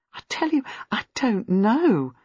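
whispered at first, then slow and tense; tense, nervy denial, breath going; strained and nervy. A narrator's reading. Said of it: "beginning to look strained and nervy".

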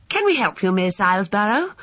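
high, polite schoolboy offer. A narrator's reading. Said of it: "asked Stoddart West politely".

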